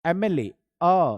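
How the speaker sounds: एमएलए drawn out slowly, then a loud long आ; slow demo. Shown as a demonstration. Teacher sounding out एमएलए slowly so pupils hear the first sound. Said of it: "उच्चारण तो करके देखिए जरा".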